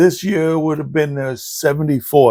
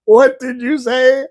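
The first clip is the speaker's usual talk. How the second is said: slow, higher mock asking; retold hard-of-hearing exchange; 7 semitones higher, slower than usual. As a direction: imitate grandparents who can't hear each other. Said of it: "He said this three times".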